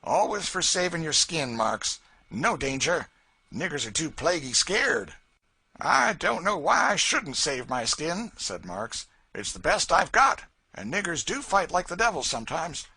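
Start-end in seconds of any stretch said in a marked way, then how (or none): 0.0-5.1 mock a coward with contempt; sneering. A narrator's reading. Tom to Marks, a loud sneer. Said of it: "with a sneer". 5.8-8.3 louder and higher, nervous caution; fretting about getting shot. A narrator's reading. Marks warning Tom, nervous. 9.3-12.9 louder and higher, nervous caution; fretting about getting shot. A narrator's reading. Marks warning Tom, nervous.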